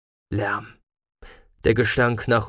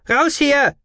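even higher, loud panicked yell; hysterical scream; 5 dB louder than usual. A narrator's reading. Marv's second cry as robot takes aim, hysterical. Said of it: "brüllte jemand in höchster Hysterie".

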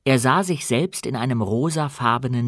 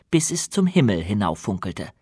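usual speaking voice

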